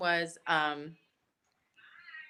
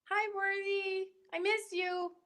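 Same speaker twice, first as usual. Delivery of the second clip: high, soft, slow coo; sweet, small voice for a friend she misses; cooing greeting; 14 semitones higher, 5 dB quieter, slower than usual. Real, not acted. Telling her absent friend she misses her, in a high soft coo.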